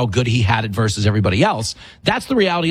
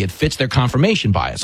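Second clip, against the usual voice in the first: usual speaking voice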